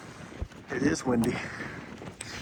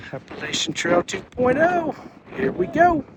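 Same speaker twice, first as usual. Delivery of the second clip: excited; 10 semitones higher, 5 dB louder than usual. Real, not acted. Hiker's catchphrase intro.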